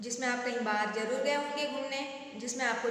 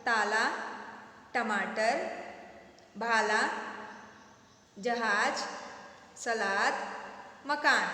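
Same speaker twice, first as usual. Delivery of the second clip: put-on each word said clearly for children to repeat; slow, clear words with pauses for the class; teacher's repeat-after-me; 2 semitones lower, much slower than usual. Shown as a demonstration. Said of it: "मेरे बाद दोहराइएगा".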